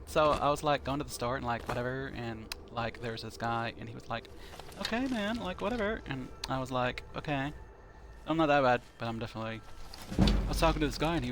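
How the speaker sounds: put-on a playful, mild Valley Girl accent with lots of "like". Shown as a demonstration. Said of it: "That's kinda my Valley Girl accent".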